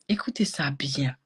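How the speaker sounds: chuchoté pour capter l'attention; chuchoté complice. Real, not acted. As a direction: souffler la consigne à voix basse. (murmure complice au public)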